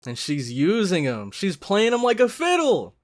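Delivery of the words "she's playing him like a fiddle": indignant shout. Real, not acted. Outraged at character.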